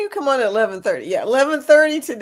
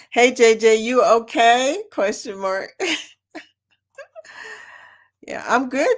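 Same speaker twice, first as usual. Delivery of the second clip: ordinary talk, nothing marked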